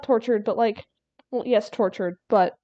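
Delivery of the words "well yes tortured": ordinary talk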